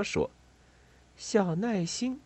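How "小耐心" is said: soft, high, drawn-out murmur; 轻声念着. A narrator's reading. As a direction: sound the name out slowly and tenderly. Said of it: "菲比念着". (轻柔地慢慢念出名字)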